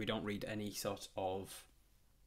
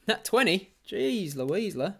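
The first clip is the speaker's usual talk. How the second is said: high, loud disbelief; incredulous exclamation, pitched way up; real astonishment; 10 semitones higher, 12 dB louder, slower than usual. Real, not acted. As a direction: blurt it out, stunned by a lucky roll. Real reaction to lucky roll.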